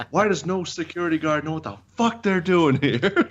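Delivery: high, exasperated rant at security; pissed. Retold or imitated. To clueless guards, an exasperated high rant. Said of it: "Like, I was pissed".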